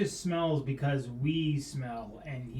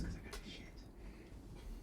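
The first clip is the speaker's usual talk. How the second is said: slow whispered confession; whispered aside; 16 dB quieter, much slower than usual. Real, not acted. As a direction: mutter it under your breath, slowly. Muttered aside.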